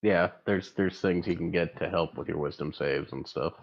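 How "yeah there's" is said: ordinary talk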